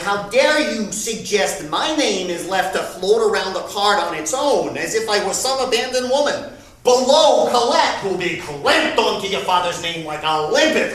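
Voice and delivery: loud, outraged mother voice; indignant mother. Retold or imitated. Son retelling his mother's outraged reply on the phone, indignant. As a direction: bristle with wounded pride. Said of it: "She said".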